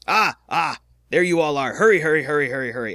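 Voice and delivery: loud, high, urgent summons of the town elder. Acted. Town elder urgently waves the heroes over, loud and high. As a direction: hurry them over, almost shouting. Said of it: "and motions you all over".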